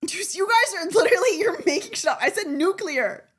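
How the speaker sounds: worked-up, high and loud arguing; mad. Real, not acted. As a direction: argue back loudly in exasperation. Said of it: "You just made me mad".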